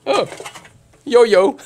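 high, bursting into laughter; delighted laugh. Real, not acted. Finds a yo-yo in the lunchbox.

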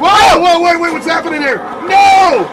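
loud, high alarmed yelling; excited shouting. Real, not acted. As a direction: yell at the screen in disbelief. Watching a wrestling move.

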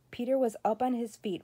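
ordinary delivery, nothing marked

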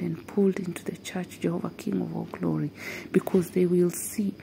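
normal talking voice

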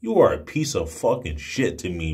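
normal talking voice

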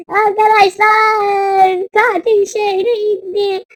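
high, drawn-out loud alarm; dramatic wail. Pretend. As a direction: wail the warning slowly to everyone. Warns viewers of killer. (feryat eder gibi uzatarak)

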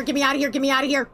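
ordinary talk, nothing marked

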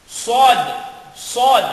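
Sod hissed full and heavy like whistling; heavy whistling Arabic sad; emphatic Arabic sad. Shown as a demonstration. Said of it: "parang kang sumisipol".

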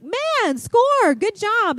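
excited cheer. Retold or imitated. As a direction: cheer your son's shot like you just won. Son shoots the buck.

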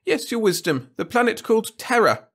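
high, anxious courtier voice; Wirt's whiny voice. A narrator's reading. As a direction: plead nervously with the king. Wirt voiced.

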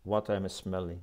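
ordinary delivery

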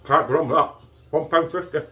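talking while chewing; words round a bite of pizza; mouth full. Real, not acted. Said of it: "I'm talking with my mouth full".

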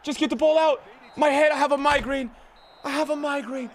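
ordinary talk

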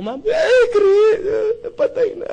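high wailing voice of a crying widow; imitating a sobbing widow, pitched high. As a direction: wail it out like a grieving wife. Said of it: "iyak, eh".

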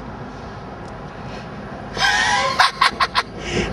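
high gleeful laugh; a shrieking laugh of glee; delighted cackle. Real, not acted. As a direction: burst out cackling. Laughs at crying woman.

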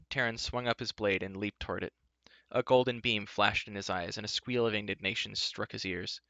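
ordinary delivery, nothing marked

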